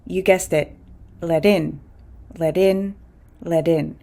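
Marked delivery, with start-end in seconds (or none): 1.2-4.0 "let in" with the T as a quick D, like "led in". Shown as a demonstration. Teacher shows learners "let in" with a quick D sound. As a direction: link "let in" with a fast tapped D. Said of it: "with a quick D".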